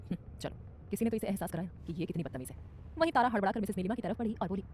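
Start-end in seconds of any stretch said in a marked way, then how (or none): 0.1-2.6 मन ही मन. A narrator's reading. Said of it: "मन ही मन".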